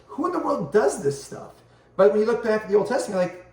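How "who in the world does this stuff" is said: high, baffled disbelief; mock outrage, pitched up high; incredulous. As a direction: ask it as if you can't believe anyone would.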